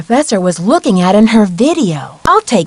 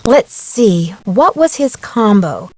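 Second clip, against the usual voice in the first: ordinary talk, nothing marked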